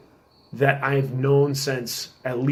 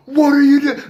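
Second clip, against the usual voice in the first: put-on much higher and louder, scolding the kid; angry yell; 13 semitones higher, 9 dB louder than usual. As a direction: yell at him like a furious mentor. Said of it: "I start lighting into this kid".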